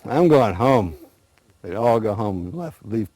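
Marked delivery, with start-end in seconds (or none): 0.0-0.9 loud, fed-up groaning complaint; acted disgust, punched out loudly. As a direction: complain like you've had enough. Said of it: "the animals would all get disgusted".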